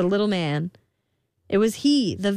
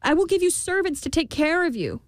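high, anxious queen offering gold; pleading queen; 11 semitones higher than usual. A narrator's reading. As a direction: plead with the imp. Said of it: "said the queen".

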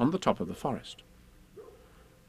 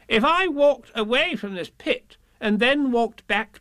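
Rabbit voice; 11 semitones higher, 4 dB louder than usual. Acted. Rabbit voicing.

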